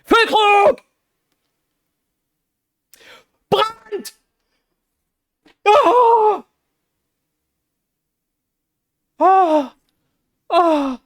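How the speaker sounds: very high, loud calls of the players' names; excited shouting. Real, not acted. Fan screaming at his team's attack, very high and loud. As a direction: scream the names as the chance builds.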